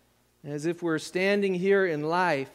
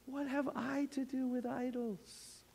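tender plea; 6 dB quieter than usual. Acted. Preacher voicing the Lord's tender plea, softer. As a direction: say it gently, as a hurt friend. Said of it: "Do you hear the personal thing".

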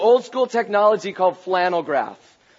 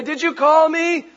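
lightly voiced eager boy, higher pitch; young Samuel; 9 semitones higher, 3 dB louder than usual. Young Samuel asks sleeping Eli, higher boyish question.

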